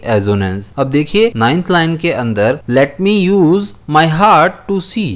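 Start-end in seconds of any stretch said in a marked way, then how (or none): 2.7-5.1 teacher reading the poem line slowly to the class. Shown as a demonstration. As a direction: read the line slowly, word by word, and lift to see at the end. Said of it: "अब me और see".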